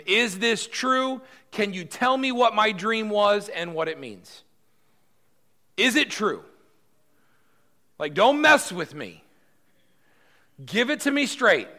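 high, loud, slow royal demand; a king who won't be fooled. Acted. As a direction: press him hard, no nonsense. King demanding answer from Daniel.

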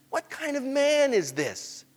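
slow, high-pitched wonder; open amazement. Acted. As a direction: ask it aloud, astonished. Amazed disciples, second fuller take.

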